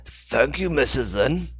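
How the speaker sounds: the wrong character voice. Acted. Dixon thanks Hannah, slipped into the wrong voice. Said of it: "I'm using the wrong voice here".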